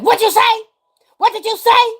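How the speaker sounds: high, loud mock shout; intimidating demand. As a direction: imitate people shouting you down. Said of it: "Trying to intimidate me".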